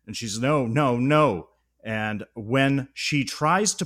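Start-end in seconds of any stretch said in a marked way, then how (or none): 0.4-1.5 mild mock panic, raised voice; a louder, lightly panicked protest; retold panic. Podcaster retelling Ronnie's terror at the fly's transformation, mildly panicked. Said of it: "Ronnie begins to panic".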